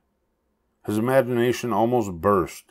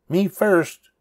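eager cry; 11 semitones higher, 3 dB louder than usual. A narrator's reading. Pinkerton's catchphrase rings out.

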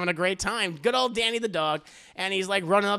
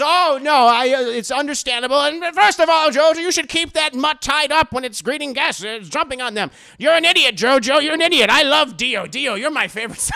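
higher, louder blustering lord; pompous father; 8 semitones higher, 9 dB louder than usual. Retold or imitated. Lord Joestar scolding JoJo, loud pompous voice. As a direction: scold the son, dote on the guest.

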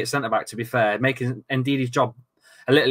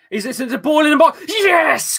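excited goal shout; 10 semitones higher, 4 dB louder than usual. Real, not acted.

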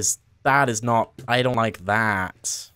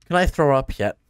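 ordinary talk, nothing marked

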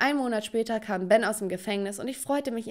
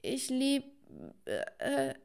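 soft, higher, halting stammer; struggling to say it; 4 semitones higher, 6 dB quieter, much slower than usual. Acted. Girl tries confessing love to Ben, halting stammer. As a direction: get stuck on the words, breaking off shyly. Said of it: "ich versuchte es auszusprechen".

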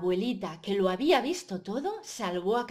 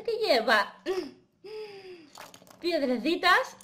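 louder, delighted reveal; excited wonder; 9 semitones higher, 6 dB louder than usual. Pretend. Storyteller revealing the box's contents, excited wonder. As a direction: marvel at a surprise find. Opening the box, finding little stones.

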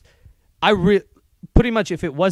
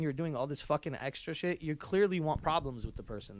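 usual speaking voice

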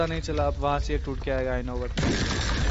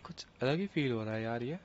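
much softer, reflective murmur; hushed awe; 2 semitones lower, 9 dB quieter than usual. Real, not acted. Gamer at the calm final chapter, hushed and soft. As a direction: take it in quietly, moved. Quiet ending scene.

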